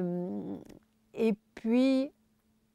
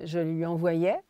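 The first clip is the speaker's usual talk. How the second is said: ordinary talk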